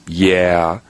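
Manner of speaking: a light, offhand yeah in passing; a passing woman's casual yeah. Retold or imitated. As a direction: toss off a quick agreeing yeah. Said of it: "the timing in which she says".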